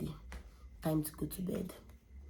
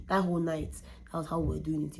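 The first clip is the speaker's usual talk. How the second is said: usual speaking voice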